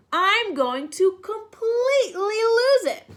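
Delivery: high, loud, half-crying mock outburst; hammed-up crayon about to snap; mock meltdown. Purple crayon threatens to lose it.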